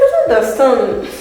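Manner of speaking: loud, high outburst with laughter; mock bafflement. Student repeating her reply to friends, loud mock bafflement. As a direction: burst out in exaggerated disbelief.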